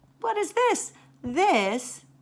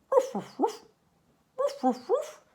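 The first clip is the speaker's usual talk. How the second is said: high playful barking; high yappy woofs; puppy barks. Acted. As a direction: bark like a little dog. Dog barks in story.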